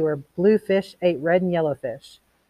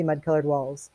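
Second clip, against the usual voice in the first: usual speaking voice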